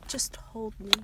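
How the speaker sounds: low, barely audible acted plea; hushed plea. Wounded boy asks the girl for comfort, hushed plea. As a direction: murmur it faintly, low and intimate.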